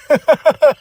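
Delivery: loud high laugh; high burst of laughter. Real, not acted. Fan laughing at Drew's insult, loud and high.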